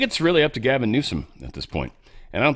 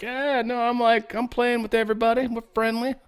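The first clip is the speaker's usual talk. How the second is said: high-pitched, softer mock politician voice; Gretchen Whitmer; 11 semitones higher, 3 dB quieter than usual. Host mimicking politician playing friendly with everybody, high mocking impression. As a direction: put on a much higher voice to mimic her. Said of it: "she was like".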